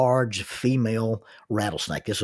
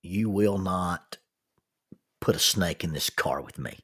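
firm refusal; 4 semitones lower, 4 dB quieter than usual. Retold or imitated. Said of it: "Jackie says".

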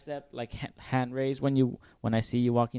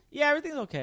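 much higher, faking understanding; fake agreeable voice; 13 semitones higher, much faster than usual. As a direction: nod along pretending to follow. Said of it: "I'm like".

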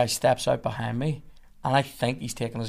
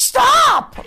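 huge, high-pitched bellow of command; imitated shout; 10 dB louder than usual. Cop with hand on gun to driver, imitated bellow. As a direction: yell it at full volume like the cop. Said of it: "Like that, right?".

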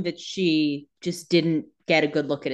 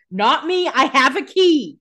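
louder, slow, pointed quote; mock sly Caroline; 4 semitones higher, 6 dB louder, slower than usual. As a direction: savor the clever loophole.

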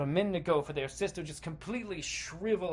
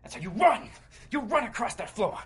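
higher, urgent action storytelling; frantic narration; 6 semitones higher, 6 dB louder than usual. A narrator's reading. Player flees cackling cannibals across the floor, urgent. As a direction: drive the chase along excitedly.

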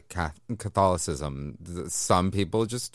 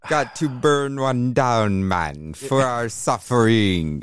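slow stoner voice; 4 semitones higher, 5 dB louder, slower than usual. Acted. Stoner joke about Passover.

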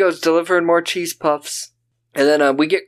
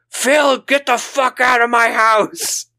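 hammed-up angry mom throwing Phil out; mock furious mom; 7 semitones higher, slower than usual. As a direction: Susan mock screaming at Phil. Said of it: "she's like".

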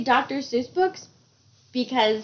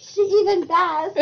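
laughing through it; giggling words; 6 semitones higher than usual. Real, not acted. Laughing hard at goat bleat.

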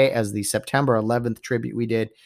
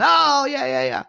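mock crowd cheer; 11 semitones higher than usual. Seattle fans roaring after the fourth-down stop, mock cheer. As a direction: cheer like the home fans going wild.

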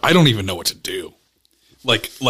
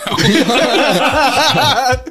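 laughing; 12 semitones higher than usual. Real, not acted. Teasing friend, laughing.

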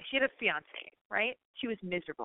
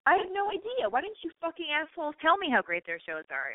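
excited outburst; 9 semitones higher than usual. Real, not acted. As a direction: pitch jumps way up, thrilled. Gushing about the show.

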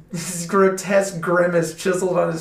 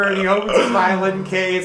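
ordinary talk, nothing marked

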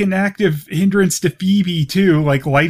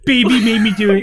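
put-on higher, childish baby talk; 6 semitones higher, faster than usual. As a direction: whine it like a toddler. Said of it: "she says it in a baby voice".

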